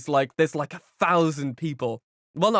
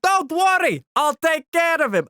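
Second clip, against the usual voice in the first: high, loud confident promise in battle; loud reassurance; 10 semitones higher, 5 dB louder than usual. Retold or imitated. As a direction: shout it like you've got this. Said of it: "he's just like".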